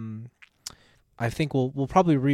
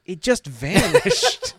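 laughing; 13 semitones higher, 3 dB louder, much slower than usual. Real, not acted. Laughing about vanished year.

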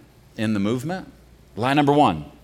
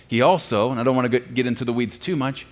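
ordinary talk, nothing marked

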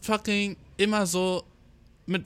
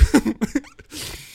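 burst of laughter; 6 semitones higher than usual. Real, not acted. Laughs at own rant.

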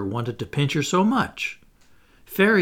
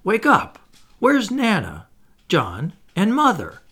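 put-on high, louder child's plea; crying out; 7 semitones higher, 5 dB louder than usual. A narrator's reading. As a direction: call out to wake a sleeping brother. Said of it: "Michael would cry".

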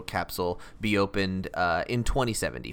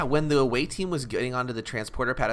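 ordinary delivery

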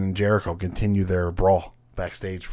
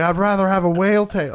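put-on southern accent; 9 semitones higher, 3 dB louder than usual. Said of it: "why she has a southern accent".

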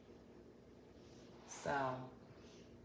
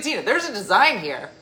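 louder, higher indignant insistence; mock mad; 5 semitones higher, 11 dB louder than usual. The landscaper getting mad at Dina over the design. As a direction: play his wounded pride, loud. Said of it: "He got real mad".

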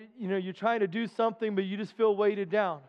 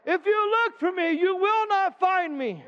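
higher, slow, rising exhortation; preaching at full force; 8 semitones higher than usual. Real, not acted. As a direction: urge them to come along. Closing exhortation.

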